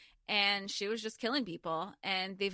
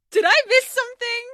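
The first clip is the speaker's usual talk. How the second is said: much higher and louder, startled disbelief; mock confusion; 18 dB louder than usual. Reader recalling Aelin's god line, shrill surprise. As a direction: squeal it like you missed a big reveal. Retelling her confused reaction.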